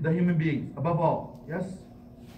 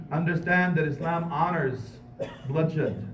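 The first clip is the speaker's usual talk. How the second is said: ordinary delivery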